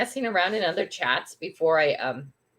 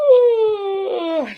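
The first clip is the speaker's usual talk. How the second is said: excited ooh; 11 semitones higher, 8 dB louder than usual. Real, not acted. Greets a newcomer excitedly.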